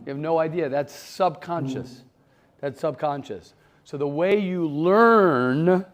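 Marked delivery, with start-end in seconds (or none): 3.8-5.8 "learn" drawn out slow and louder; stress on learn. Shown as a demonstration. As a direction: underline the word "learn" by stretching it. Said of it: "underline the word learn".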